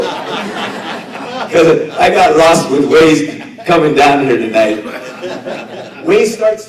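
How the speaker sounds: laughing loudly through the words. Real, not acted. Speaker laughs at himself to the crowd, laughing loudly. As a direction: chuckle out the joke about getting lost. Joking about getting lost with Waze.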